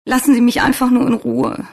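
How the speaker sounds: high, loud, upset voice; tearful pleading. A narrator's reading. Svenja to the insistent nurse, tearful pleading. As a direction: plead tearfully to be left alone.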